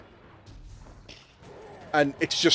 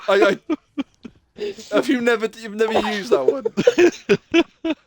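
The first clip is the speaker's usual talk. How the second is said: higher, laughing through the question; 6 semitones higher, 4 dB louder, much slower than usual. Real, not acted. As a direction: struggle to talk while laughing. Laughing while asking.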